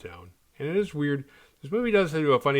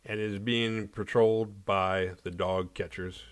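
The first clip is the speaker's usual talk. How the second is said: ordinary talk, nothing marked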